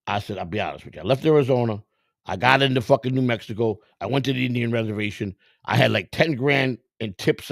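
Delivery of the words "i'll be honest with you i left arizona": usual speaking voice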